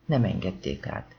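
ordinary delivery

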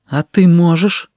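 ordinary delivery

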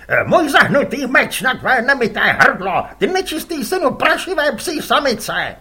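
loud stream of angry abuse; raging insults. Acted. Arif insults the other fisherman, raging. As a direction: spit insults at the rival.

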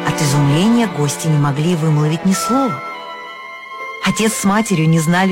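plain reading voice

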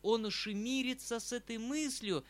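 a despairing exclamation to himself. Acted. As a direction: despair breaking through the words. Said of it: "сказал я в отчаянии".

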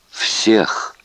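hoarse-edged whisper of one word. A narrator's reading. Prisoner warning his captor, whispered. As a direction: whisper it as a defiant verdict. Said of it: "прошептал он своими растрескавшимися губами".

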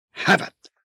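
short, mildly sharp summons; snapped call. A narrator's reading. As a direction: snap out the name. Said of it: "csattant fel Jessica". (kurtán felcsattanva)

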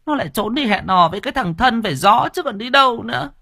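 very high, resentful nagging voice; aggrieved wife. Acted. Wife still smarting answers her husband, very high and resentful. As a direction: whine it up high, still hurt. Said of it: "giọng vẫn còn ấm ức".

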